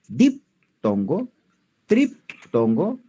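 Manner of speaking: teacher dictating the terms, spelling out each word slowly; slow drawn-out terms. Shown as a demonstration. Teacher naming the three types to students, slow and drawn out. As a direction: stretch diptongo and triptongo out slowly. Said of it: "hablamos de concurrencias vocálicas".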